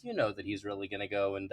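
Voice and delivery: ordinary delivery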